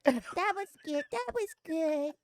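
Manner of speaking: high, slow prim praise; dainty ladylike voice. Retold or imitated. Mocking Mari's ladylike praise after clearing her plate, slow and high. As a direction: mimic a lady savoring her meal, slow and high. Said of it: "imitating Mari ladylike".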